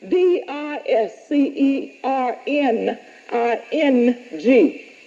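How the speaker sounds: D I S C E R N I N G letter by letter, slow; spelled out. Shown as a demonstration.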